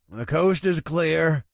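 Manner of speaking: slow, bored man's voice; eye-rolling. Acted. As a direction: drawl it, unimpressed. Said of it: "Paul said with a roll of his eyes".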